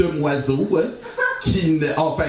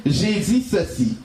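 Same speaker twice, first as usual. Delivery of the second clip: ordinary delivery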